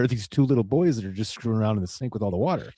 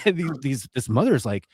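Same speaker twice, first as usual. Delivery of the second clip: ordinary talk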